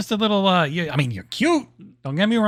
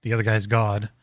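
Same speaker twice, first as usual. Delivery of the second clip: lower mock lecturing; 9 semitones lower, 4 dB quieter than usual. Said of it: "gonna be like".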